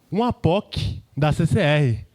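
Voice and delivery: usual speaking voice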